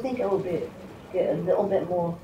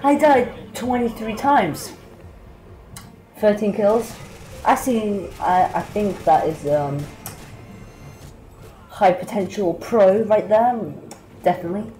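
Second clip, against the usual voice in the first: mock yourself with a laugh; laughing sarcasm; 5 semitones higher, 6 dB louder than usual. Real, not acted. Gamer mocking his own bad score, laughing loudly.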